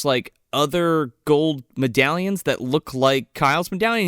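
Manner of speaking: ordinary delivery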